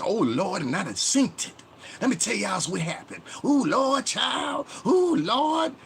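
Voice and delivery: very high, loud hammed-up squeal; mocking podcaster impression. Mocking how people talk on podcasts, hammed-up high squeal. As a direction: exaggerate a dramatic gossip in piercing falsetto. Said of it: "you start talking like this".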